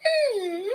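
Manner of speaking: high little dog whimper; high-pitched mock whimper; imitated dog whine. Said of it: "and then she goes".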